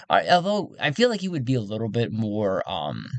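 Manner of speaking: ordinary delivery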